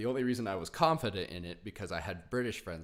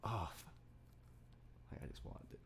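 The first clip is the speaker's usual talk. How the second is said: whisper; 11 dB quieter, faster than usual. Real, not acted. Whispered longing.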